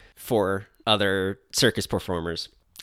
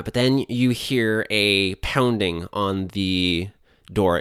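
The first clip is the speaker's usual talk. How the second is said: normal talking voice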